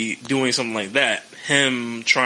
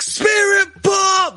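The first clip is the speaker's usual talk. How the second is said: much higher and louder, slow stretched shout; hyped commentator call; 5 dB louder, much slower than usual. Retold or imitated. Imitating NXT commentators calling the finisher in a huge shout. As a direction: belt the move name like an announcer going wild. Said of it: "how they used to call it in N X T".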